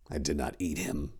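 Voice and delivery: low, soft clenched reply; through gritted teeth. A narrator's reading. Tor answering the abbot's accusation, through gritted teeth. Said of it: "through gritted teeth".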